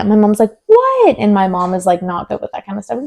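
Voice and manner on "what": high-pitched, incredulous mock "what"; shocked what. Retelling mom's reaction to the news, shocked. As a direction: imitate a stunned mom, pitch shooting up.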